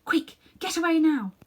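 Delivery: put-on high, loud urgent girl's voice. Polly urging Diggory to flee, high urgent voice. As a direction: hurry him off as Polly.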